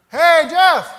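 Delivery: very loud, high shout of Jeff's name; coach calling out. Retold or imitated. Coach shouts at patient from under the bed.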